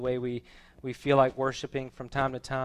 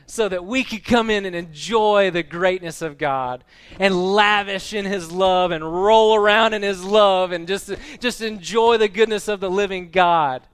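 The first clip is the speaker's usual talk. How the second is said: loud, raised, exultant preaching; fervent preaching; 5 semitones higher, 10 dB louder than usual. Real, not acted. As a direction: preach it out with swelling force.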